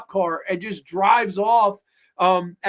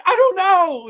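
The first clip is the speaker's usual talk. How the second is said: high, loud helpless reply; hammed-up high-pitched outburst; mock frantic yell; 12 semitones higher, 8 dB louder, slower than usual. Yelled answer in chase quarrel.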